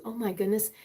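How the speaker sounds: usual speaking voice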